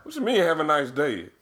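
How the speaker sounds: very high, incredulous squawk; comic baffled retort; mock incredulous. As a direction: throw the greeting back in high-pitched disbelief. Acted incredulous reply to Russian greeting.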